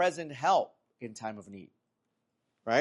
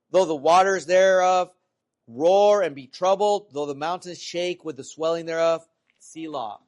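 raised, forceful scripture reading; 3 semitones higher, 7 dB louder than usual. Acted. Preacher reads the psalm aloud, loud scripture reading. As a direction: declaim the verse loudly to the congregation.

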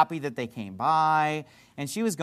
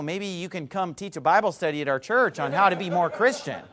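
ordinary talk

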